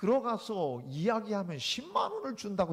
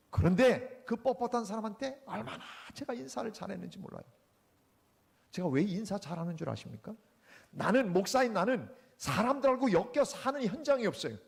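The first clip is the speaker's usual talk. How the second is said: ordinary talk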